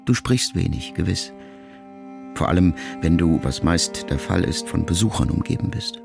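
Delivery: plain reading voice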